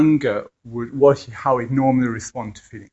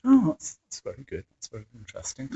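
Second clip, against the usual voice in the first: awkward polite reply; faster than usual. Retold or imitated. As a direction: placate without knowing what to say. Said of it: "you just go".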